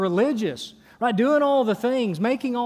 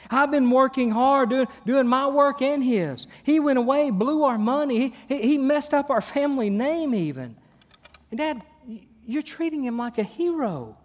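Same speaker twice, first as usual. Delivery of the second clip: indignant complaint; 5 semitones higher than usual. Acted. Son complains to father.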